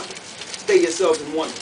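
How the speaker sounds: ordinary talk, nothing marked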